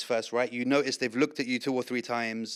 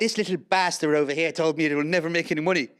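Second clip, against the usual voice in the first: loud, higher Irish pub banter; Irish accent on the edge of laughing; 4 semitones higher, 6 dB louder than usual. Pretend. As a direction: grumble comically in put-on Irish. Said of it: "You actually do a good Irish accent".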